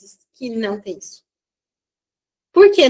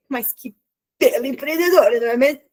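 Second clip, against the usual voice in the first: sarcastic praise. Acted. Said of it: "Eu julgo".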